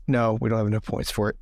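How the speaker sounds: ordinary talk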